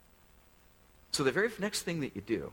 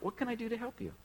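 "What can I do to help you?" pitched much higher and a bit softer; softer high echo of the offer; lifted, softer repeat; 9 semitones higher, 3 dB quieter, faster than usual. Shown as a demonstration. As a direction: float the offer up high and gentle. Second take, lifted.

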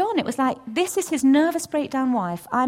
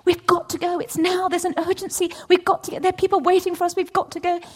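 higher, insistent urging; urgent pleading; 7 semitones higher, 4 dB louder than usual. Retold or imitated. Wife pressing husband during a row, insistent higher urging. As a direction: press him hard, it has to be now.

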